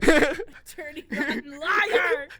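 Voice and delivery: loud, drawn-out cackling jeer; playfully outraged drawl; mock accusation. Mock accusation amid laughter.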